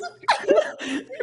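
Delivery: high wheezing laugh; shrieking with laughter; helpless laughter. Real, not acted. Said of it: "you can't stop laughing".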